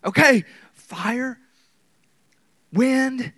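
high, booming preacher's shout; dramatic shouting. Acted. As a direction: cry out as if amid disaster. Acting out the spectacular signs.

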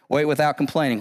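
ordinary talk, nothing marked

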